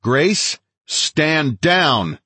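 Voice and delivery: high, forceful order to stand down; shouted command. A narrator's reading. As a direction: shout it with the pitch well up. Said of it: "I shouted".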